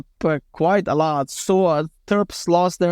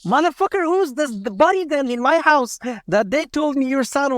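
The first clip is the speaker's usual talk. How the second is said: high-pitched mock-angry demand; mildly acted exasperation, pitched up; retold indignant outburst; 7 semitones higher than usual. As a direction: imitate a father's incredulous demand, pitch jumping up. Father angrily questions living son.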